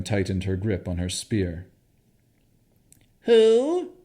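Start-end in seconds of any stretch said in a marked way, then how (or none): 3.2-3.9 high, strange hag voice; creature voice. Acted. The hag to the intruders, high hag voice. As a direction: croak it out like a startled old hag. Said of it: "the creature asked".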